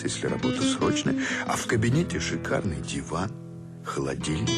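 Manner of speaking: ordinary talk, nothing marked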